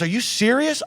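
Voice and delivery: wife stunned it was really him; surprised. Retold or imitated. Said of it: "She goes".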